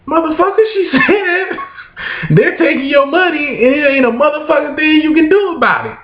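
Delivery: wife's voice pitched high through laughter; mock the wife cackling as she repeats it; laughing wife. Wife laughing at her husband, high and giggly. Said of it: "It's like".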